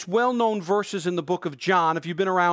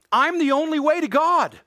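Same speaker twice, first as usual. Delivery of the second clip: raised, high-pitched preaching cry; emphatic declaration; 8 semitones higher, 5 dB louder than usual. Acted. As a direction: proclaim it loud and high to the congregation. Preacher voices Jesus' emphatic declaration.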